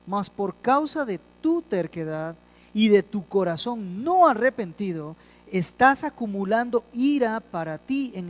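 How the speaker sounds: forceful, measured reading from the pulpit; emphatic Bible reading; deliberate scripture reading. Real, not acted. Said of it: "lo voy a leer despacio".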